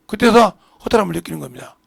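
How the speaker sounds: ordinary talk, nothing marked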